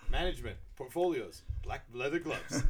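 a hint of mock salesmanship; imitated presenter. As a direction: list items like a showy presenter. Acting out a business presentation.

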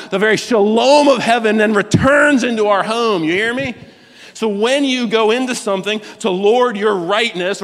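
high-pitched, driving sermon delivery; impassioned preaching. Real, not acted. Preaches at full force.

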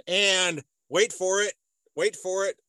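drawn-out teasing build-up; building suspense. Real, not acted. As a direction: hold them in suspense before the reveal. Building suspense before a reveal.

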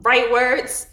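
slow, exaggerated proclamation; mock declaration. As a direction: announce it like a rebel plan. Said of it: "I was like".

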